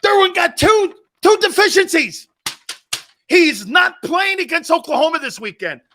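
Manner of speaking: high, loud furious shout; angry mom yelling. Retold or imitated. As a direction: yell at the coach like an outraged mom.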